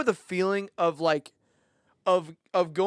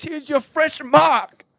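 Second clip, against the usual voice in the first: high, slow, drawn-out waiter voice; mock waiter; 8 semitones higher, 4 dB louder, much slower than usual. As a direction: stretch it out like a proud waiter. Retelling the waiter's line.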